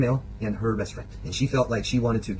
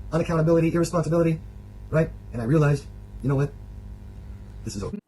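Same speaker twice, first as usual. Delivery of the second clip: ordinary delivery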